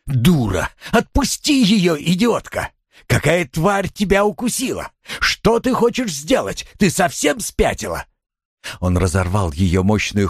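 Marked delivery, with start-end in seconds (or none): 0.1-8.1 high-pitched angry outburst; furious scolding. A narrator's reading. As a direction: berate her to make her let go. Husband rages, pulling wife off victim.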